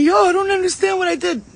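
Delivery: very high put-on protest; mock bewilderment. Ex-friend texting after being dropped, high mocking imitation. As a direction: mimic a clueless complaint way up in pitch.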